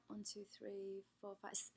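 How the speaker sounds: counting softly to herself under her breath; soft muttered tally; quiet counting. Real, not acted. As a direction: murmur the count as you tally.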